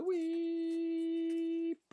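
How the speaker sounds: long, very high "weee" noise; high squeal. Real, not acted. Streamer spinning the miniature round, high playful squeal. Sound effect while spinning model.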